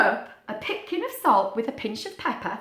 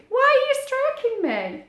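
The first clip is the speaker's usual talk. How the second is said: squirrel puppet voice; 13 semitones higher, 3 dB louder than usual. Acted.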